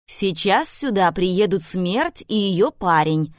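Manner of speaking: plain reading voice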